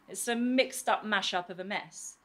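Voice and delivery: ordinary delivery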